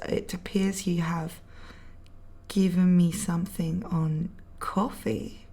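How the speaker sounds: hushed unvoiced whisper. Real, not acted. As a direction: breathe the words like a secret. Reading a chat message aloud.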